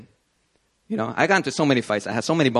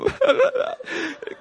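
mock crying wail. Retelling himself crying.

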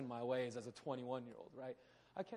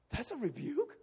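higher, louder incredulous question; mock surprise; 10 semitones higher, 7 dB louder than usual. Young preacher to friends, higher and louder, surprised. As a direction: sound genuinely baffled.

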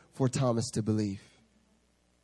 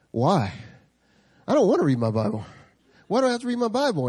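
louder, rising mock whine; whiny protest; 7 semitones higher, 6 dB louder, faster than usual. Small group guys refusing the pastor, mock whiny. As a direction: complain like a stubborn student. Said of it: "they were like".

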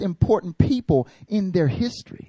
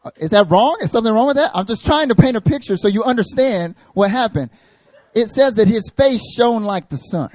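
mock defensive; 6 semitones higher, 6 dB louder than usual. Real, not acted. Defends joke to laughing crowd.

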